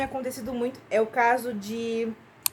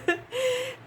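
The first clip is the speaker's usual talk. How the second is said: embarrassed laugh; 12 semitones higher than usual. Real, not acted. Embarrassed at her own outburst.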